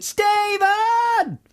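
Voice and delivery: long high shout of Stephen!; shouted name. Retold or imitated. Wife calling out to find her pal, long high shout. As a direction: call out to find your lost friend. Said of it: "I shouted".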